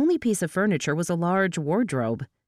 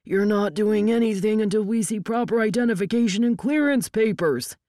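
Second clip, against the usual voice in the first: raised, official-sounding refusal; higher-pitched, insistent standoff voice; shouted demand; 5 semitones higher than usual. A narrator's reading. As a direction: bark the rule at rival agents. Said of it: "E I A lookalikes shouted".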